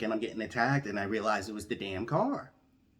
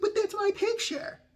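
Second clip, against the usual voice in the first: much higher, wounded squeal; high indignant protest; 3 dB louder than usual. Retold or imitated. Protesting to DMV staff about his photo, high wounded squeal. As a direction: plead it in a high wail.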